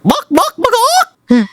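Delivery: very high, full-on chicken clucking; committed chicken squawk. Shown as a demonstration. User shows the AI how, committed chicken squawk. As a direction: squawk like a chicken, really get into it. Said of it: "You gotta get into it".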